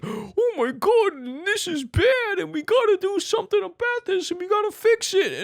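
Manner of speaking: gasp, then whine high like a sobbing alarmist; hammed-up tearful squeal; mock panic. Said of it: "gaslight you and be like".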